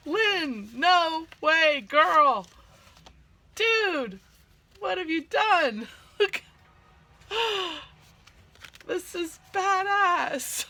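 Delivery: high, loud squeals of delight; real excitement. Real, not acted. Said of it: "I'm so excited".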